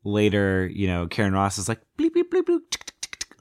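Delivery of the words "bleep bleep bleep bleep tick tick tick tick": computer noises. Retold or imitated. Scientist working on the computer, imitated bleeps and ticks. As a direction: imitate beeping and key clicks. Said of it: "on the computer".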